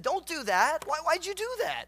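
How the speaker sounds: high, loud disbelieving objection; acting out exasperated advisers; alarmed protest. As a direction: plead loudly, pitch climbing into an incredulous question. Acted elders protesting to king.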